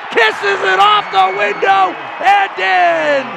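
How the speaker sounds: high, very loud, drawn-out call; full-voice play-by-play eruption; excited basketball shout. Real, not acted. Calling Rubio's scoring play.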